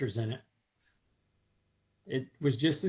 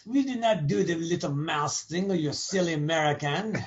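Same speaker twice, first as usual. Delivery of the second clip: a light mock French accent as snooty Parisians; bad French accent. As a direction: play a haughty Parisian, French accent kept loose. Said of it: "That was my bad French accent".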